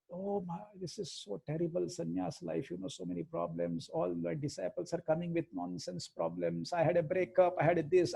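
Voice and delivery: soft, private venting to a close friend; confidential complaint. Retold or imitated. Swami confiding sannyas problems to an old friend, soft confidential grumble. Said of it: "when we meet confidentially, this is how we speak".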